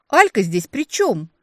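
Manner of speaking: high, loud, slow indignation; indignant outburst. A narrator's reading. Woman protesting her sister's involvement, indignant outburst. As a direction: explode with indignant disbelief. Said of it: "взорвалась негодованием я".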